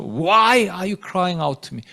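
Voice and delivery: slow, reproachful question with drawn-out WHY; rebuking question. Shown as a demonstration. God rebuking Moses for crying out, slow stern reproach. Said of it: "책망하시는 것 같아요".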